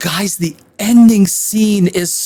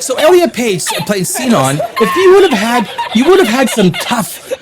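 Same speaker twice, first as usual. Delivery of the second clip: laughing; 8 semitones higher than usual. Real, not acted. Reviewer laughing at the casting.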